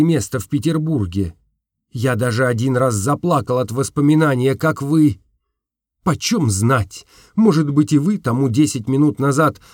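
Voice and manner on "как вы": ordinary delivery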